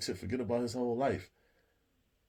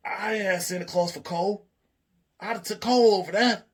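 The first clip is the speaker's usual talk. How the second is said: high, loud, aggrieved whine; whiny Chip; 8 semitones higher, 6 dB louder than usual. Retold or imitated. Chip complaining to Meech about getting slapped, whiny. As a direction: whine like a hurt kid.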